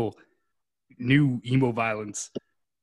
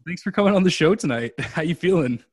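upbeat, louder, quicker host intro; energetic redo; 5 semitones higher, 4 dB louder than usual. Shown as a demonstration. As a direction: punch up the welcome with energy. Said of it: "do it again".

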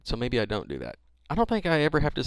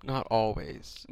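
slow, drawn-out correction at usual volume; measured repeat; 4 dB quieter, much slower than usual. Real, not acted. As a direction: repeat it slowly for someone who missed it. Repeats it after "What?.

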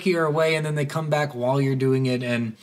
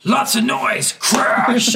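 higher, louder mock crash; mock crash sound; 8 semitones higher, 4 dB louder, much slower than usual. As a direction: announce the crash as a joke. Acting the noise.

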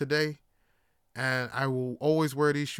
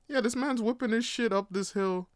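ordinary talk, nothing marked

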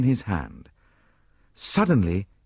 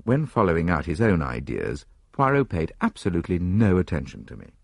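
ordinary delivery, nothing marked

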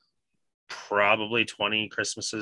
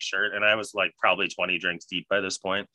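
ordinary talk

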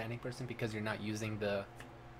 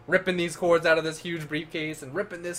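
loud, higher, building rant; excited; 7 semitones higher, 8 dB louder than usual. Real, not acted. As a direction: gush about the cords.

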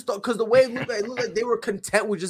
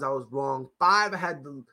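ordinary talk, nothing marked

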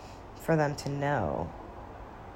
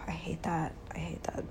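breathy whispered aside; 9 dB quieter than usual. Real, not acted. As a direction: whisper it to yourself. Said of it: "whispered aside".

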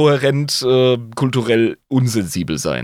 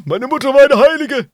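mock offended voice; 6 dB louder than usual. Mock offense at mother joke.

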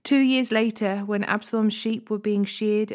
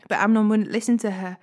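ordinary delivery, nothing marked

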